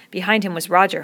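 ordinary delivery

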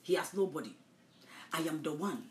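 ordinary delivery

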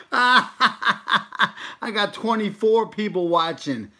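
slow, gloating laugh through words. Real, not acted. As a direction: laugh while bragging. Gloating over viewer count.

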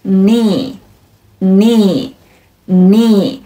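"knee" said slowly as "nee"; silent K. Shown as a demonstration. Teacher drilling a body word, "knee" with silent K. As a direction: begin "knee" on the N, pausing between repeats. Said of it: "开头的字母K是不发音的".

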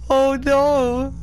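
shrieking high and loud in fright; jump-scared yelp and laugh; startled scream. Real, not acted. Jump scare in ghost game.

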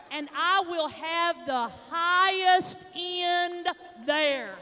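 slow, loud, drawn-out boast; big joking boast. Retold or imitated. Telling the caller her rear end is the highest, slowly and loudly. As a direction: stretch every word out, loud and proud.

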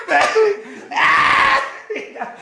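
high, loud screeching laugh; shriek-laugh as if electrocuted; electrified frenzied cackle. Acted. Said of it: "se puso eléctrico". (carcajada chillona, como eléctrico)

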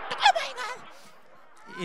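very high squeal of Oh my God; American hen. Retold or imitated. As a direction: squeak it like a snooty gringa hen. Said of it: "Así en inglés y todo".

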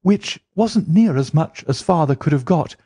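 high, eager voice of a gossiping typist; Mary telling Holmes about her mother's remarriage, high and eager; chatty young woman. Acted. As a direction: rattle through the family history. Mary tells family story.

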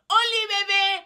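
high squealed hello, held out; ear-splitting greeting. Acted. Regina hails her friend to everyone's annoyance, ear-splitting. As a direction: squeal it at your sweetheart. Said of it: "ojalá los oídos se pudieran apagar".

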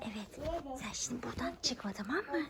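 hushed whisper to the doll. Real, not acted. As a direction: keep it low so she can't hear. Hiding the doll, talking to it quietly.

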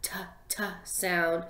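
"t" as a crisp voiceless tap; whispered t. Shown as a demonstration. Teacher modelling the t sound for kids. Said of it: "we're gonna do the t".

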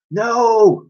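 usual speaking voice

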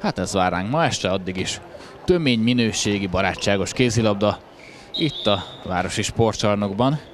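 normal talking voice

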